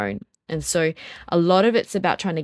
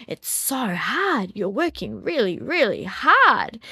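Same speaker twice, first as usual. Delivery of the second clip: gentle acknowledgement; 5 semitones higher, 3 dB louder, slower than usual. Retold or imitated. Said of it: "but I acknowledged him again".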